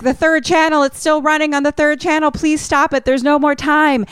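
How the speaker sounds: high, frantic retold begging; mock panic on the phone; acted desperate plea. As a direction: plead urgently, pitched far up. Acting out man's desperate phone plea.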